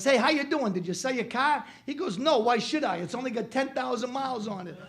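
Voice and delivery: high, loud joke voices; Italian guys' punchline. Acted. Two Italian guys meet again, high and loud. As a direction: deliver the punchline bright. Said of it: "The guy goes".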